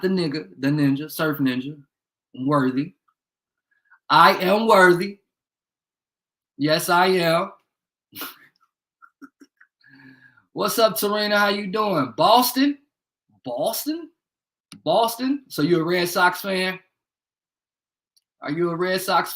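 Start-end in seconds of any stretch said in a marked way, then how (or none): 4.1-7.5 louder, slower, proud and emphatic; slow, loud self-proclamation; proud declaration. Acted. Repeats his claim, louder. 12.2-15.4 surprised. Real, not acted. Reacts to viewer's city.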